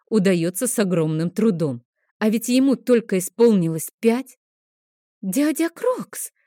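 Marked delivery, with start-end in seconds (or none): 5.2-6.3 put-on much higher, slow excited girl; a girl suddenly crying out a name; surprised childish outburst; sudden exclamation. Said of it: "внезапно воскликнула Адель".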